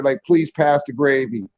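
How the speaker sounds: slow, with the stress on please; polite request. Shown as a demonstration. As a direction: ask it politely, leaning on please. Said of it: "it's a courtesy gesture".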